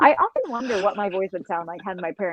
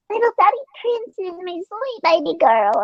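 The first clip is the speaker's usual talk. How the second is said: put-on very high, cutesy baby talk to a cat; imitated high-pitched pet cooing; 10 semitones higher than usual. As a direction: gush over a pet in a sugary high voice. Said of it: "talking to her in that voice".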